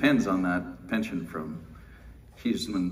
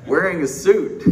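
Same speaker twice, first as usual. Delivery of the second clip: laughing; 9 semitones higher, 9 dB louder than usual. Real, not acted. Joking about suit at conference.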